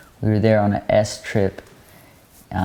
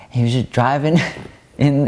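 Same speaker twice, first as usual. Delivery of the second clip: usual speaking voice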